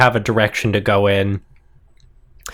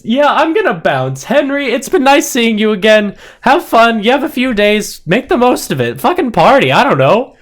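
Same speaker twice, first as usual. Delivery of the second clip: breezy, high-pitched character voice; Stolas; 10 semitones higher, 4 dB louder than usual. Acted. Game master voices Stolas bidding Henry goodbye, breezily high. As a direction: toss off a casual farewell in a high voice.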